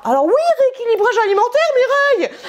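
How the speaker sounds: high, fast jokey call-out to a friend; mock-defensive teasing. Real, not acted. Calling out to friend Mireille about her diet, playfully high and fast. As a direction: tease Mireille as if caught out.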